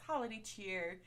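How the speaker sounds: ordinary delivery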